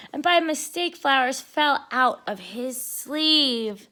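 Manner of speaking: ordinary delivery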